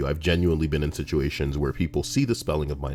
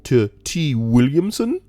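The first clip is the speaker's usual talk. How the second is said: higher-pitched voice for the new hire; new guy asking; 9 semitones higher, 4 dB louder than usual. Retold or imitated. New hire asking the help desk, high put-on voice. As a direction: put on a high voice for a polite request. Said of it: "the new guy asks".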